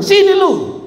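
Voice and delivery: high mock-menacing summons; mother summoning. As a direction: call the child over like a mother in trouble-mode. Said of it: "Lalu dia bilang".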